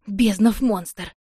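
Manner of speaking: slow, loud, strained curse; indignant outburst. Acted. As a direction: spit out the curse in outrage. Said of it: "возмутилась она". (возмущённый, медленный и громкий возглас)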